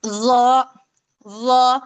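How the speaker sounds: ज as a drawn-out, heavy "zaw". Shown as a demonstration. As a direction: swell into a thick, dark ज. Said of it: "इसे बहुत मोटा और ऊंचा पढ़ना है".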